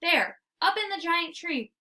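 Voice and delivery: plain reading voice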